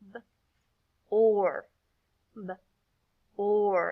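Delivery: "B", pause, then "or"; individual sounds. Shown as a demonstration. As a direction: sound out "B, or" separately. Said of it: "Say each individual sound with me".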